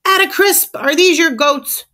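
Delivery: high, loud outraged yell; angry shout. A narrator's reading. Furious neighbor confronts Landlady Crisp about her goats, angry shout. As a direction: bellow it as an accusation. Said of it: "spit flies as he shouts".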